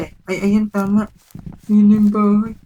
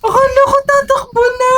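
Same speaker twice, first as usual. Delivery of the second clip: high, loud frightened cry; scared cry; 14 semitones higher, 6 dB louder than usual. Real, not acted. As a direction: cry out in real alarm. Startled, thought it would run.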